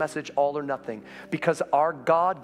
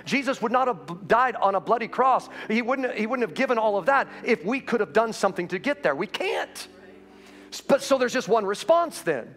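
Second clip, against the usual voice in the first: preaching at full force; 7 semitones higher than usual. Acted. Pastor urging the Easter congregation, impassioned preaching. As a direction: preach it out with rising fire.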